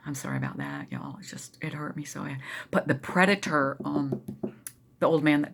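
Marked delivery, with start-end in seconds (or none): 0.1-2.4 broken, whispered apology on the edge of tears; tearful whisper. Real, not acted. Apologizing to viewers for her tears, a tearful whisper. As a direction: barely get the words out, close to crying. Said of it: "I'm getting tears thinking about it".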